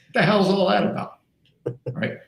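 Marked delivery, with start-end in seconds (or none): 0.1-1.2 high, louder mock outrage; exasperated boss scolding staff, pitched high; incredulous rant. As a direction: throw up your hands in disbelief.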